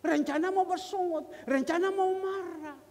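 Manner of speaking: loud, high-pitched emphatic outburst. Retold or imitated. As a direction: act out rising temper, drawing out the end. Retelling his urge to get angry.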